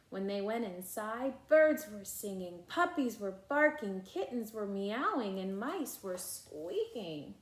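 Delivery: put-on lively read-aloud voice for children; animated storybook reading with character lines; story narration. A narrator's reading. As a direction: read it like a bedtime picture book. Reading picture book aloud.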